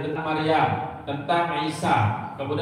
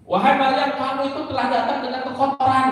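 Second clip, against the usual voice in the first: raised, accusing call to Maryam; mock denunciation in a higher pitch; retold scolding; 7 semitones higher than usual. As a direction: accuse her publicly, pitched well up. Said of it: "Terus dicaci maki".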